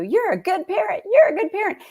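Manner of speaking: high, loud sing-song praise; mock praise. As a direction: gush it like a proud cheerleader.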